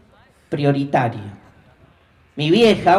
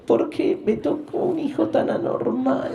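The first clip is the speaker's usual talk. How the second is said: higher, hushed fake sob; mock crying mom; 8 semitones higher, 3 dB quieter than usual. Son retells his mom crying over him, mock tearful wail. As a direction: wail it like a mother in theatrical despair. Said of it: "empezó a llorar así".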